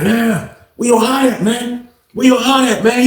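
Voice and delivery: put-on high, laughing taunt of a drunk bully; mocking taunt. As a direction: jeer at him, laughing.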